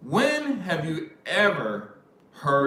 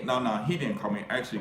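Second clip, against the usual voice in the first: normal talking voice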